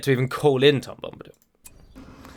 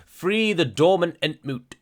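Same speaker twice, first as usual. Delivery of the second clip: put-on ultra posh British accent. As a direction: read it like absurd old-fashioned British upper crust. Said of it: "in an ultra posh voice".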